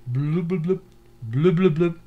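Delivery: imitated bubbling regulator noise; bubble sounds. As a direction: bloop like a diver breathing underwater. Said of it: "they're like".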